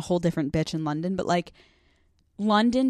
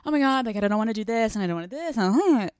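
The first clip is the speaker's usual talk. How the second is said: mock complaining, pitch swooping up and down; Debbie Downer whine; 3 semitones higher than usual. Host mocks her earlier reluctance about the wedding, whiny downer. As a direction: whine it like a reluctant killjoy, hammed-up. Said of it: "Debbie Downer".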